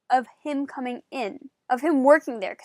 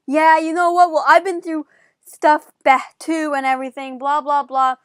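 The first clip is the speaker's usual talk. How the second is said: louder, heated mock comeback; heated retort; 3 semitones higher, 8 dB louder than usual. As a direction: snap back at him, fired up. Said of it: "'cause I'm like".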